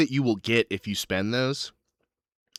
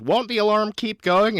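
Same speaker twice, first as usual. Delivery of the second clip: much higher questioning voice; Susanna; 12 semitones higher, 5 dB louder than usual. Acted. Companion questions the plan, in a high voice. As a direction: put on a high voice for a worried query.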